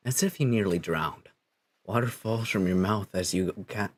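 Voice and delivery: low, measured storyteller; game narration. Acted. Narrator telling a player how they woke on a beach, measured narration. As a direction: narrate it evenly, a little low.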